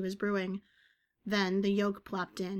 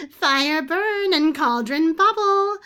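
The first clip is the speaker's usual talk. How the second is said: high, loud, slow witchy chant; gravelly commentary; 8 semitones higher, 12 dB louder, slower than usual. A narrator's reading. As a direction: intone it like a cackling hag, drawn out. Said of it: "came the gravelly commentary".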